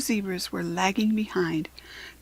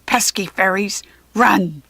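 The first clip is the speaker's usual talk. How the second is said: loud goblin shout; 6 dB louder than usual. A narrator's reading. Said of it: "he yelled".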